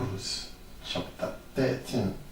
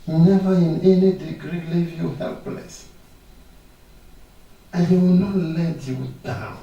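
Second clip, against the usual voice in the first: louder, driving proclamation on "never"; preaching at full force; 5 semitones higher, 5 dB louder than usual. Acted. Preacher assuring the sick congregation, fervent proclamation. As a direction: hammer each "never" home to the congregation.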